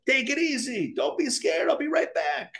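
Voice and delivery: high, gentle reassurance; calm the scared part of you; soothing self-talk. Retold or imitated. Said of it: "You tell yourself".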